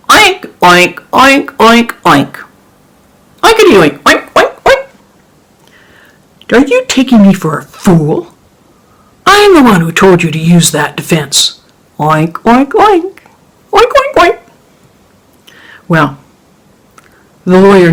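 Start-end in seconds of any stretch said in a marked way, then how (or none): 0.1-4.9 high squealing pig noises; pig oinks. Acted. Farmer oinks at lawyer. 12.0-14.4 high squealing pig noises; pig oinks. Acted. Farmer oinks at lawyer.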